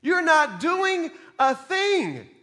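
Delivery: high, loud, exasperated complaint; indignant Martha. Acted. Martha fuming at Mary for sitting idle, high and loud. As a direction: whine it out like the sister left with the work.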